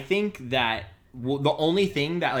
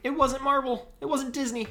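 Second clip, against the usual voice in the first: high, silly put-on voice; comic high-pitched protest; mock studio spokesman; 12 semitones higher than usual. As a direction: insist on studio credit in a squeaky mock voice.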